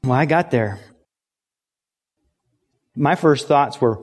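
ordinary talk, nothing marked

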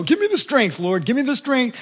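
high, pleading repetition; acted-out anxious supplication; pleading mock prayer. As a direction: beg God for strength in a put-on voice.

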